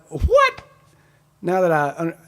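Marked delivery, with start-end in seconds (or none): 0.1-0.6 high-pitched, rising baffled "what"; incredulous reaction. Retold or imitated. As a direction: re-enact your sharp, disbelieving confusion. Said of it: "And I was like".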